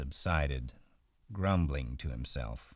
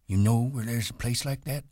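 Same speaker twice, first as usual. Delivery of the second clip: put-on higher, reedy old man, eager; old Candy; 7 semitones higher, 5 dB louder than usual. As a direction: blurt it out, hopeful.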